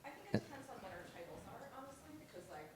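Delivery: talking while chewing; words round a mouthful of food; mouth full. Real, not acted. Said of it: "sorry, my mouth is full again".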